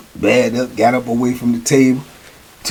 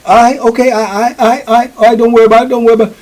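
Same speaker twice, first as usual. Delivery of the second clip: higher, mock easygoing; old timer backing off; 8 semitones higher, much faster than usual. Old timer pretending to drop it with the young boy, easy. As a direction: let it go, lightly. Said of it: "Dude say".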